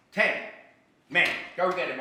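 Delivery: barked order. Acted. Officer barking orders.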